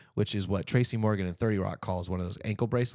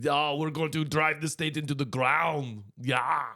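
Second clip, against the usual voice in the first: Arnold Schwarzenegger impression in a mock Austrian voice; 3 semitones higher than usual. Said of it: "That's my impression of Arnold Schwarzenegger".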